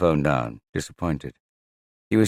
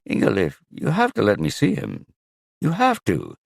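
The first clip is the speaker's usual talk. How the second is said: much higher, pleading insistence; desperate plea; 7 semitones higher, 4 dB louder than usual. Acted. Magnus desperately begging Ingalif to let him see his son. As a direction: beg her, voice straining up.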